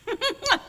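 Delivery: short high burst of laughter; amused high laugh. Real, not acted. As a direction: laugh at the absurd task. Laughs at the recordings.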